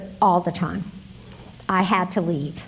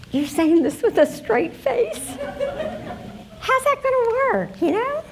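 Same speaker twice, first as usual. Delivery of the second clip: high-pitched, retold disbelief; acted-out incredulity, pitch climbing; incredulous; 12 semitones higher than usual. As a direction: question the plan as if it can't be serious. Incredulous at friend's plan.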